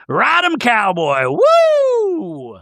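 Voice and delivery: high, loud, slow-then-whooping yell; hammed-up wild whoop. [name] cheering Captain Jason on the island, hammed-up whoop. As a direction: whoop it like a rodeo.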